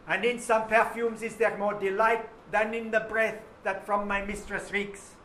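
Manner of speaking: loud, high, hammed-up French-tinged recital; mock Old English reading. Teacher hamming the sonnet for the class in mock Old English. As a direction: declaim it like a pretend olden-days reader. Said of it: "I'm gonna read it like it sounds in Old English".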